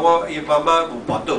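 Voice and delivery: ordinary talk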